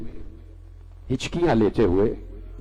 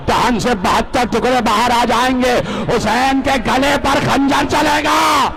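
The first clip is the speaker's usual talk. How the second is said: impassioned preaching; 8 semitones higher, 3 dB louder than usual. Acted. Prophet foretelling his grandsons' deaths, impassioned, building to a cry. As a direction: preach at full force, pitch climbing to the final words.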